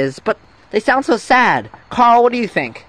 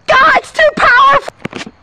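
high-pitched strained yell; hammed-up panicked shout; overwhelmed shout. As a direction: cry out as if blasted backwards. Blown away by cry's shockwave.

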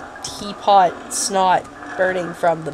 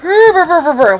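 mock shiver; 8 semitones higher, 8 dB louder than usual. Shivering noise in game.

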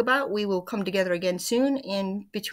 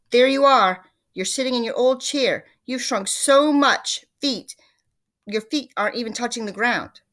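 raised, forceful storybook shout; 5 semitones higher, 9 dB louder than usual. A narrator's reading. Mister Twit pointing at his wife in her chair, shouting. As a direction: shout it out, higher and much louder. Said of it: "pointed at her and shouted".

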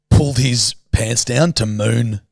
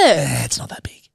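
whisper; 3 semitones higher than usual. Real, not acted. Dismissive aside, unvoiced.

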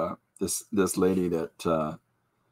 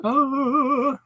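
mock child wail. Son's cry voiced.

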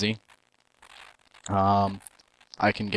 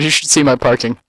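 normal talking voice

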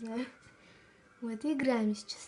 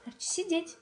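talking to a dog; 6 semitones higher than usual. Real, not acted. Dog command.

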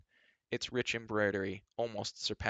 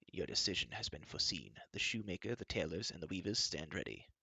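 lower, softer formal servant voice; bow and report gravely; solemn steward; 3 semitones lower, 6 dB quieter than usual. A narrator's reading. Steward answers the queen.

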